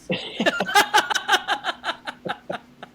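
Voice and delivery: long, loud, high-pitched laugh; high cackling burst of laughter; real laughter. Real, not acted. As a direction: crack up at your own punchline. Laughing after the story's punchline.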